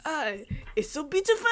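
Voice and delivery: mock French gushing with a laugh; bad French accent. Shown as a demonstration. Kat showing her habit of bad French accents. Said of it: "it's so hard not to just go".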